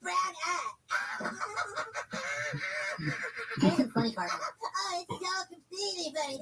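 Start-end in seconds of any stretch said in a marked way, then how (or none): 0.8-3.5 shrieking laugh. Acted. Laughing at milk carton.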